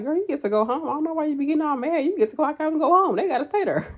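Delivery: high, quick, loud husband impression; voicing her husband. Wife quoting husband's pep talk, high animated imitation. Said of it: "my husband has told me that before. He be like".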